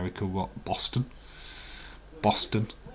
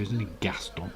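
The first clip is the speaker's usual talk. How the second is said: usual speaking voice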